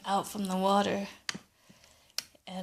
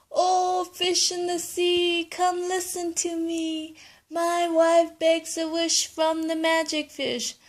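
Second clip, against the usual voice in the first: high singsong chant to the fish; calling out the rhyme; 8 semitones higher than usual. Acted. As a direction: call the magic fish from the shore. Grandpa calls the fish rhyme.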